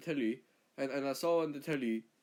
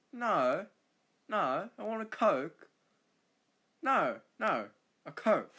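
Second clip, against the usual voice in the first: loud, swooping Australian vowel on "No" and "Coke"; Australian pronunciation; 5 semitones higher, 5 dB louder, slower than usual. Shown as a demonstration. Australian demonstrating the vowel, exaggerated Australian accent on "No" and "Coke". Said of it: "we say, "Oh." Like".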